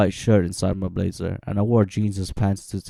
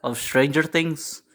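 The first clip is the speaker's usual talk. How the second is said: Irish or Scottish accent; 6 semitones higher than usual. Real, not acted. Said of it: "what it sounded like when I had foreign accent syndrome".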